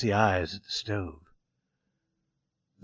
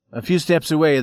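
plain reading voice

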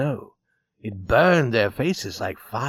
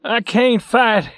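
broken down; 9 semitones higher, 6 dB louder than usual. A narrator's reading. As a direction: barely get the words out. Beaten son breaking down.

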